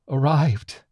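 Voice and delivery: a dying creature's strained call; hoarse fading cry. Acted. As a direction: call out weakly as strength ebbs. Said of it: "cried out, its voice fading and hoarse".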